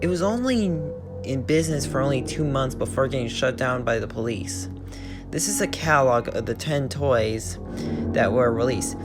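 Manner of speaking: plain reading voice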